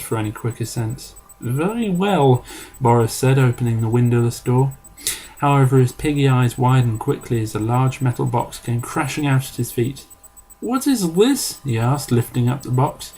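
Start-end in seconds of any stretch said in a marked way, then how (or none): 1.4-2.4 put-on slow, high Eastern European accent; hammed-up Eastern European voice, pitched up; thick Eastern European accent. A narrator's reading. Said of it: "in a thick Eastern European accent". 10.6-11.6 put-on slow, very high Eastern European accent; puzzled question in a broad Eastern European accent; thick Eastern European accent. A narrator's reading. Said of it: "in a thick Eastern European accent".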